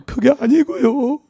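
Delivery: imitating a sobbing widow, high and slow; a light mock sob in the voice; tearful reply. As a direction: mimic her choked, teary protest. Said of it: "그냥 서럽게 울으셔".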